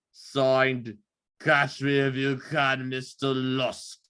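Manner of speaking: lightly voiced drunk Cockney killer reading a letter; drunk Cockney accent. Shown as a demonstration. Reading the Ripper letter for friends in a light drunk Cockney accent. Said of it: "like this drunk Cockney accent".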